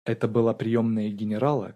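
plain reading voice

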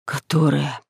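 low, sighed echo; weary low sighing repeat; heavy sigh. A narrator's reading. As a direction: sigh the word out heavily, low. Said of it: "вздохнул кто-то тяжело".

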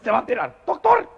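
high falsetto outraged mother; mother confronts the doctor, high and indignant. Acted. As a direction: scold the doctor in a shrill falsetto.

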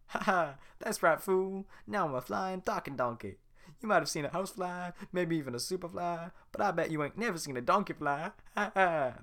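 flying donkey taunting the guards. Acted. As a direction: brag and cackle mid-air. Donkey gloating in flight.

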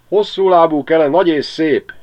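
high, loud, chirping praise; csiripelő veréb. A narrator's reading. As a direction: sing out the stork's praises like a sparrow. (a veréb dicséri Kelét, magas, csiripelő hangon)

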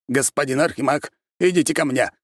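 high, insistent summons; urgent calling. A narrator's reading. Aristocrat prisoner summons the archmage, high and urgent.

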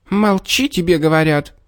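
high fox squeal at normal volume; exasperated squeal. A narrator's reading. Fox snaps at the pestering bear, high squeal. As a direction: lose patience, voice jumping high. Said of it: "не выдержав, взвизгнула лиса".

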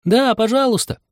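very high, eager plea; pleading chorus. A narrator's reading. The others joining Anya's plea, calling eagerly. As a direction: chime in with a high-pitched plea. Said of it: "все остальные присоединились хором".

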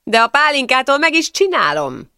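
expansive, loosely spoken boast; loosely articulated. A narrator's reading. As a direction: swing the pitch up with drunken bravado. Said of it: "nagyvonalúan artikulálva Somogyi".

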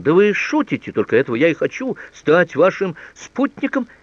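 high, loud, eager outburst; пылкое восклицание. A narrator's reading. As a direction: blurt out consent, stretching the final words. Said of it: "воскликнул он". (восторженно, громко, высоким голосом)

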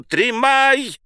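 high, drawn-out yell; stretched high wail; wailing cry. A narrator's reading. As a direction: cry out in alarm to stop someone. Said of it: "с воплем".

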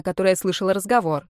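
usual speaking voice

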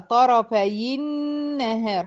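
slow "طرفي النهار" as tarafayi-nnahar; drawn-out tajweed example, "طرفي النهار" linked by i; demonstrating the kasra link. Shown as a demonstration. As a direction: hold the doubled n in "طرفي النهار" after i. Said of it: "حركتها بالكسر".

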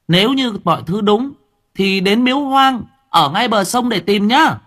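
put-on high, quick child's voice; boy's voice. A narrator's reading. Boy urging the official's wife to dig under the tree, high child voice. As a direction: narrator lifts into a small boy pleading urgently.

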